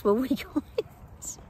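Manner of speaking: laughing. Real, not acted. Joking to viewers about flights.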